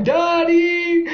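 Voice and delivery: high loud frightened cry; crying for daddy. A narrator's reading. Scared rider crying out for daddy. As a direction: wail for your dad. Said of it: "Some were crying".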